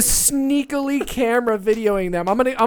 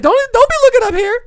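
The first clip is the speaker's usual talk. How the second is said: mock-frightened cry, pitched way up; scared retail lady; 10 dB louder than usual. Filmer warning officers off, high scared retail lady voice.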